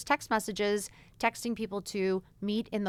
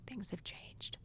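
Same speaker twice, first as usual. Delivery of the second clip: hushed ominous whisper; whispered warning to other moms; 14 dB quieter, slower than usual. Real, not acted. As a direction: drop to a whisper like a secret. Warning moms of young kids.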